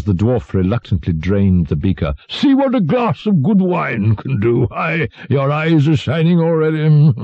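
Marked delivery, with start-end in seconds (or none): 2.3-7.1 high-pitched boisterous crowing; roaring, gleeful king. A narrator's reading. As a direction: crow over the drinker like a jovial tyrant. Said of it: "roared the latter".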